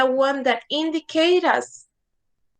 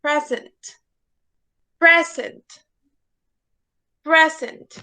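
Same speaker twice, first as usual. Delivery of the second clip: "Present" repeated as PRE-sent, a pause after each; PREZ-ent, first syllable strong; stress on first syllable. Shown as a demonstration. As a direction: say "Present" as separate demos, strong PRE, falling end. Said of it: "we have a word stress?".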